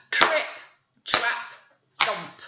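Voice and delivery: ordinary delivery, nothing marked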